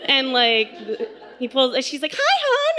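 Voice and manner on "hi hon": very high, chirpy greeting in imitation; happy mom. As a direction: play a blissfully unaware mom. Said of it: "she's so happy".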